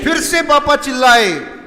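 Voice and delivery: high, loud storytelling; excited narration. A narrator's reading. Teacher telling the class a family story, high and loud. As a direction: build the drama.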